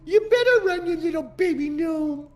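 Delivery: high-pitched jeering call; mocking taunt. Player jeering at a fleeing gnome, high taunting cry.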